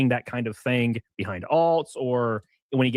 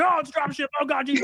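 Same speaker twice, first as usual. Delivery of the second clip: much higher, whiny mock outrage; mocking the chat. Mimicking chat reacting to drop shipping in a high mock-shocked voice. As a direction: parody a shocked commenter, pitched way up. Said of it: "they're like".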